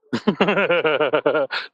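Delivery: long, rippling chuckle; laugh. Real, not acted. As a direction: chuckle at your own joke. Laughing at own slip.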